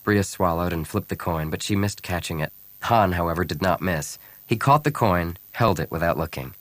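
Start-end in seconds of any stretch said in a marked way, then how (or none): none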